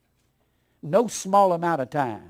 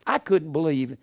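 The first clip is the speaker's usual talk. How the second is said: ordinary talk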